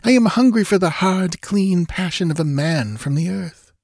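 high, pleading seduction; lightly voiced sultry woman; seductive temptress. Thalos seducing Conan.

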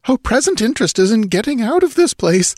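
high-pitched, pressing nobleman voice; agitated young nobleman. A narrator's reading. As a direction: urge escape in a high voice. Nobleman urges escape in high voice.

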